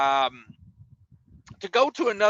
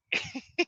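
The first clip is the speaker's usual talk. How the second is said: high laugh; 7 dB quieter than usual. Real, not acted. Laughing at the memory.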